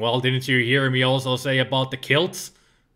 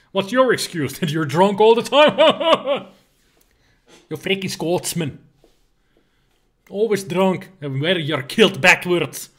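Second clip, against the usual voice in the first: put-on Scottish accent; 3 semitones higher, 4 dB louder than usual. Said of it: "How's my Scottish accent?".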